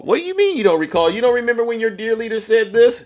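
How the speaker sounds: high, fast disbelief; incredulous taunt. Real, not acted. Streamer mocking a guest who doesn't recall, high and fast. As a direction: sound amazed they forgot.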